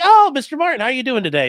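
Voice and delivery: high, loud mock-friendly judge greeting; cheery judge. Lawyer mimicking the friendly judge greeting him, high and cheery. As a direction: sing it out like a chummy judge welcoming a lawyer.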